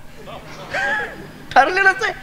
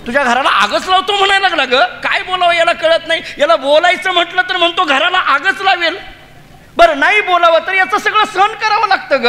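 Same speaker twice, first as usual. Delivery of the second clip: high, laughing exasperated gossip; laughing complaint; 8 semitones higher than usual. Acted. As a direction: complain through laughter, pitched up. Gopi laughingly complains of Krishna. (हसत हसत, उंच सुरात तक्रार)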